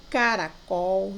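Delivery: "caracol" said slowly, syllable by syllable; sounding out. Shown as a demonstration. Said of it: "Leia em voz alta".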